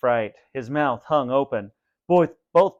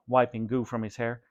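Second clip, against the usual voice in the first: ordinary delivery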